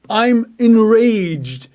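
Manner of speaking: slow, swelling enraged voice; mock rage. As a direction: stretch the words out like a man losing his temper. Acting out anger.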